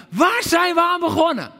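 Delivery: cry out. Acted. Preacher voicing the king crying out, loud outcry. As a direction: yell it so every soldier hears. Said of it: "want hij roept het uit".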